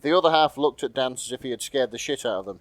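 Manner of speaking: ordinary talk, nothing marked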